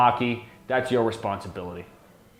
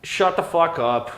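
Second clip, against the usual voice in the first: slow, laughing shut up, higher; laughing dismissal; 6 semitones higher, slower than usual. Real, not acted. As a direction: laugh it out in disbelief. Reacting to Trudeau's name.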